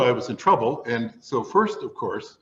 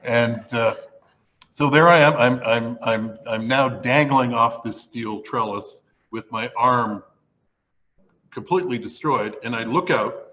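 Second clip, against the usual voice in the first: ordinary talk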